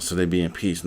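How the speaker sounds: usual speaking voice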